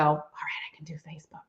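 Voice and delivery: a hushed, unvoiced self-encouragement; whispered pep talk. Shown as a demonstration. Nervous seller whispering courage to herself before going live. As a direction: whisper it to yourself after a deep breath. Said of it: "just take a deep breath and go".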